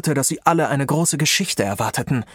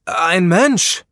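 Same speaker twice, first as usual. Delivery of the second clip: louder, higher shout of an answer; calling out; 5 semitones higher, 5 dB louder than usual. A narrator's reading. Rob calls his answer to the wizards, louder. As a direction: call it out firmly. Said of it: "rief er".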